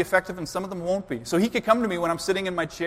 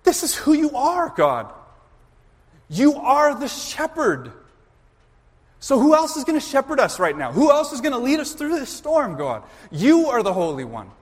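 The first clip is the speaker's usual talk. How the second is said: higher, louder pleading with God; impassioned plea; 6 semitones higher, 4 dB louder than usual. Acted. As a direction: cry out to God, reminding Him who He is. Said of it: "He's looking to God and he's saying".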